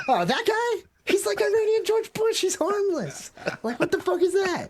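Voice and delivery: very high mock squeal, dismissive; squeaky caricature brushing off the threat. Mocking officials calling Ahmadinejad harmless, very high squeaky voice. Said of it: "you're like".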